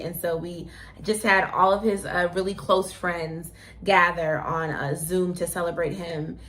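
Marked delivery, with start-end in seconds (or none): none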